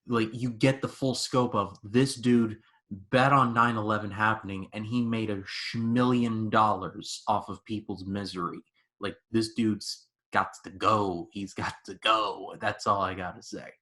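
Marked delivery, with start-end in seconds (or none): none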